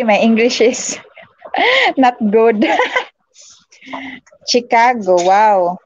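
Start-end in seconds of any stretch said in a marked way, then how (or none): none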